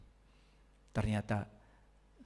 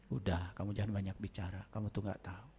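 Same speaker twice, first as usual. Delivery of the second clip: quiet teacher; 5 semitones lower, 10 dB quieter than usual. Retold or imitated. Teacher voiced in story.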